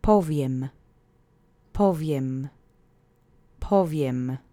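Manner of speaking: "Powiem" with stress on the first syllable, PO-wiem; Polish demo word. Shown as a demonstration. Teacher modelling the word for listeners to repeat, first-syllable stress. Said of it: "The accent is on the first syllable".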